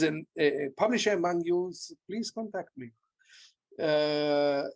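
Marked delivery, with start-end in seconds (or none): none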